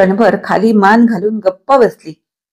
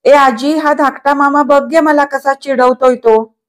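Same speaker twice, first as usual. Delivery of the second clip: lightly raised complaining call, a bit higher; 5 semitones higher than usual. A narrator's reading. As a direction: shout for grandma against the teasing uncle. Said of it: "तिने ओरडून म्हटले". (आजीला ओरडून हाक, सौम्यपणे)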